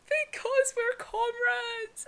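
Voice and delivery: high, impassioned retold plea; Inosuke anguished. Retelling Inosuke refusing to kill his comrade, high anguish.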